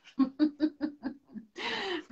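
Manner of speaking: short high laugh at her own line; light amused laugh; bright laugh. Real, not acted. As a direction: laugh it off warmly. Laughs after her own remark.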